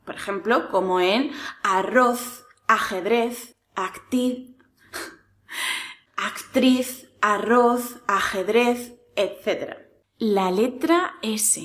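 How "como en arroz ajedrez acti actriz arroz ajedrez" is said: arroz and ajedrez ending in a th; final th. Shown as a demonstration. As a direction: end arroz with tongue between teeth. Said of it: "cuando la Z está al final". (arroz terminado en zeta)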